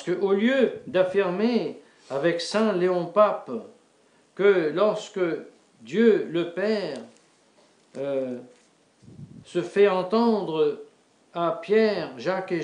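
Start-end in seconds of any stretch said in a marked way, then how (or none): none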